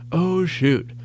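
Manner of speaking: high, put-on little-girl voice; toddler mimicry. Dad imitating his toddler copying his cursing, high-pitched.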